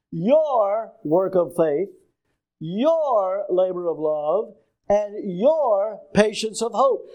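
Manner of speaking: slow, with each "your" stretched out; stress on YOUR. Shown as a demonstration. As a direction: lean long and hard on every "your". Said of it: "It means with emphasis".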